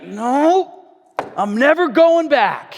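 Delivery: high, loud, slow refusal; loud vow. Retold or imitated. Friend Mitch vowing never to return to addiction, loud and firm. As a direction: declare it like a man done with his past. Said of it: "And he's like".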